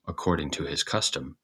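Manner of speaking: usual speaking voice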